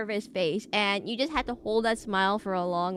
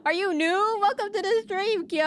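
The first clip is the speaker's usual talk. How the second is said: much higher, bright welcome; excited high greeting; 10 semitones higher than usual. Real, not acted. Streamer welcomes new viewer Kyo, excited high voice. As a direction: pitch it up to welcome him.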